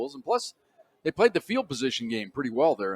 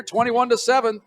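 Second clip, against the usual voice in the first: higher, louder score call; punchy radio score read; announcer score call; 9 semitones higher, 6 dB louder than usual. Real, not acted. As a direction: sell the halftime score like a broadcaster. Halftime score call, announcer lift.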